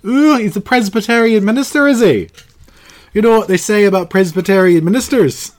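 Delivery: high, loud hammed-up Irish voice; mock Irish accent. Host teasing his co-host in a mock Irish accent. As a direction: put on a cheeky Irish brogue, giggling. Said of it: "That sounds more Irish now".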